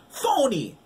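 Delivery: very high, loud jeer; yelling back. A narrator's reading. Girl yells the insult back, very high. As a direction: fling it back at her. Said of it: "Sean cried".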